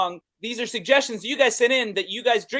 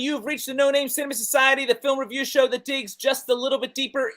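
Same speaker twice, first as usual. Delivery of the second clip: bright show-host opening, a touch raised; host announcer voice; 5 semitones higher than usual. Acted. Podcast host greets the audience in announcer manner. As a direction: welcome the audience like a presenter.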